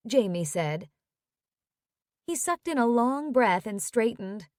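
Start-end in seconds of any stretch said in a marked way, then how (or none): none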